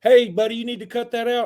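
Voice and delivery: high mock call from the water; hammed-up tough-guy warning; Aquaman calling out. Aquaman warning the attacker from the water, mock call. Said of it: "Aquaman's in the water going".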